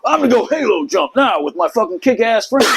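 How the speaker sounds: high-pitched mocking boast; mocking smart-ass. Mimicking the boastful SEAL to listeners, mocking smart-ass. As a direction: ridicule a show-off by imitating him. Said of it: "had to be a smart ass and like".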